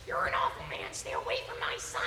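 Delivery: raspy, scolding mother, dropping low; Marge. Acted. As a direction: rasp out a protective warning. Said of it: "And Marge says".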